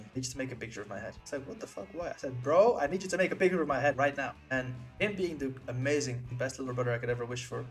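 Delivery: usual speaking voice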